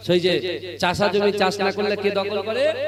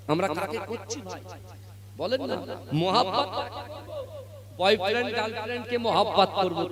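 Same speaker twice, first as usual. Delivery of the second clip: usual speaking voice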